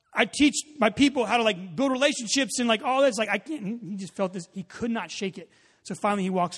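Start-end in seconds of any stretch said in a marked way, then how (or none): 0.1-3.8 loud, high-pitched objection; exasperated protest. Retold or imitated. Youth pastor arguing with an inner nudge, exasperated protest. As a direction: argue back, acting out frustrated disbelief. Friend argues against the nudge.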